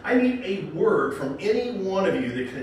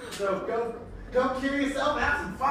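playful dad voice; 10 semitones higher than usual. Acted. Host voicing a puppet's dad.

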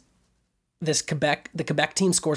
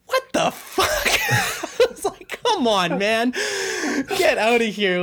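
much higher, louder mock outrage with a laugh; outraged disbelief; 13 semitones higher, 3 dB louder than usual. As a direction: squeal the disbelief, then scoff. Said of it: "And I was like".

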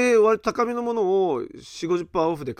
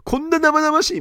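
mock shock; 11 semitones higher, 5 dB louder than usual. Locals shocked at raw meat.

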